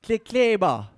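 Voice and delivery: "Klekleba" said as klik-le-ba, falling; Sotho word. Shown as a demonstration. Teaching the church to say "Klekleba" in Sotho. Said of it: "Say it loud".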